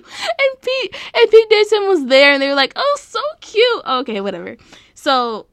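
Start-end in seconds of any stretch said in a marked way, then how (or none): 0.0-3.8 high, excited squeal; squealing with delight. Real, not acted. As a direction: squeal over how cute it is. Gushing about cute daughter.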